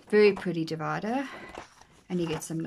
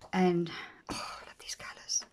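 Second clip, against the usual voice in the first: whisper; 3 dB quieter than usual. Real, not acted. Flipping planner pages, gentle awe.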